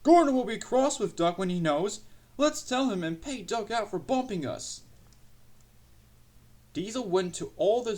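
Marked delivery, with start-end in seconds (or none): 0.0-4.8 high-pitched scheming little voices; gleeful trucks. A narrator's reading. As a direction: plot mischief in an excited high voice. Trucks gleefully plot against Duck.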